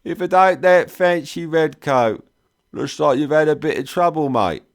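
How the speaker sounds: high, loud London cockney banter; mock the red coat in thick cockney. A narrator's reading. Trader teases the soldier in thick cockney. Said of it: "in that thick London cockney".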